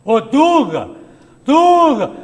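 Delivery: loud mock call across the room. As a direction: yell his name to get his attention. Host calls out to coach Dunga.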